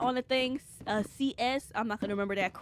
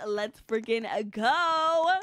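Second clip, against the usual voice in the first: loud, stretched-out hype shout; hyped cheer; 5 dB louder, much slower than usual. Real, not acted. Streamer cheers making it to the live, hyped. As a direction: drag out the last word loudly.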